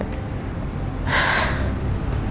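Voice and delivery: long tired exhale; weary sigh. Real, not acted. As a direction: sigh it out, worn from reading. Tired reader mid-marathon.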